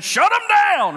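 shouted high and loud; excited shout. Retold or imitated. Preacher on a ride-along shouting to his brother. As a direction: yell it like an eager rookie cop. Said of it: "I was getting fired up".

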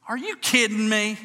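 high, loud burst of disbelief; incredulous wife. Retold or imitated. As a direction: imitate an outraged wife breaking her silence. Wife's outburst after long silence.

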